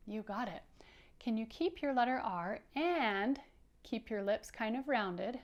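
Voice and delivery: usual speaking voice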